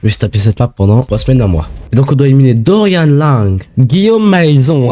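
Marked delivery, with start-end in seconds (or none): 3.8-4.9 imitation lente d'un accent anglais, syllabes étirées; « Guillaume Maison » prononcé lentement à l'anglaise, en étirant les syllabes; accent british. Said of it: "avec son accent british". (imitation lente de l'accent anglais)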